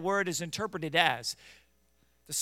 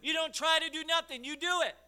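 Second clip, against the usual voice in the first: loud, rising preacher's cry; fiery revival preacher, louder and louder; preaching at full force; 5 semitones higher, 8 dB louder than usual. Acted. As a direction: build the call into a shout to God.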